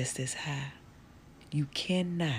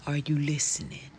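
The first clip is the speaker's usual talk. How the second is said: normal talking voice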